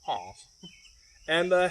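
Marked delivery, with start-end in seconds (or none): 0.0-0.4 sleepy duck honk. Acted. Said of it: "The duck dozed off".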